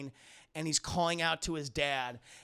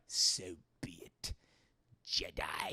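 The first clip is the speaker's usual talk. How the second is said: resentful whisper; 12 semitones lower, 4 dB quieter, slower than usual. Retold or imitated. Emperor answers Luke with a low, resentful whisper. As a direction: hiss it out with bitter resentment. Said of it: "says it with such fucking resentment".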